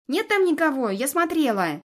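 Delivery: high, loud squeal; shrieking. Acted. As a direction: shriek it out in a jealous fit. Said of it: "С визгом кидается на меня".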